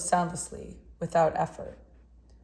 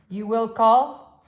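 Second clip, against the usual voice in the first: put-on strong, louder voice with a light Russian accent; a hint of Russian accent, firm and strong; vague Russian accent; 4 semitones higher, 8 dB louder, much slower than usual. A narrator's reading. Old artist introducing himself to the boy, a touch of Russian accent. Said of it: "He had a strong voice".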